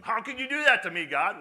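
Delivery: high, loud cry of protest; loud, hurt outburst; anguished accusation. Acted. Grieving person accuses God.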